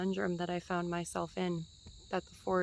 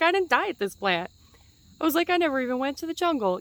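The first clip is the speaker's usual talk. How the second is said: baffled exclamation; 7 semitones higher, 6 dB louder than usual. Retold or imitated. Retelling her baffled reaction.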